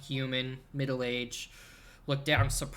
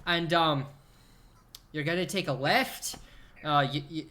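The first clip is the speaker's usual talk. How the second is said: loud, higher-pitched Scottish lilt; Scottish accent; 4 semitones higher, 5 dB louder than usual. Acted. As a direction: give directions in a put-on Scottish brogue. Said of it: "you need to affect a Scottish accent".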